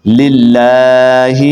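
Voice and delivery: slow tajweed model of للہ; elongated lam. Shown as a demonstration. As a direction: chant للہ with a long held "laa". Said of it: "لام کو آپ نے لمبا کرنا ہے".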